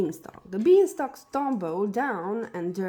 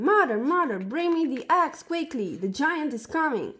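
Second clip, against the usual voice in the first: higher and louder, urgent call down to mother; shouting for help; 6 semitones higher, 4 dB louder than usual. A narrator's reading. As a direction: yell for the axe while fleeing the giant.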